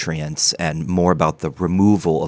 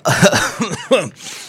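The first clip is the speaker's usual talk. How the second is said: dry cough; 13 semitones higher than usual. Acted. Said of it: "you sound a little".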